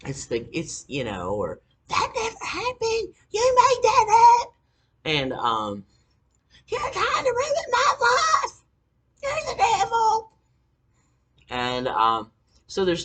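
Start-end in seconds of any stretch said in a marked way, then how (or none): 1.9-4.5 imitating mom, shrill and much higher, building to a yell; mock her furious denial in a screechy high voice; hammed-up mother shrieking denial; mother's screaming fit. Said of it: "she would just go on this fucking screaming fit". 6.7-10.3 much higher, louder crying shout, slowed; screaming fit. Retold or imitated. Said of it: "she would just go on this fucking screaming fit".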